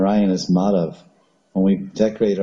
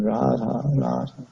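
higher, softer, tearful plea; mild acted weeping, much softer; crying and begging; 4 semitones higher, 4 dB quieter than usual. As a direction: beg for her, half-sobbing the name. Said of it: "crying and begging".

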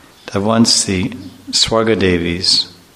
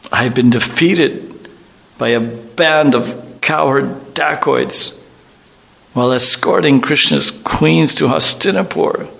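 anguished lament; 6 semitones higher than usual. Acted. Arjun laments his lost power.

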